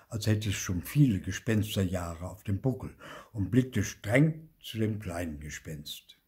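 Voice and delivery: ordinary delivery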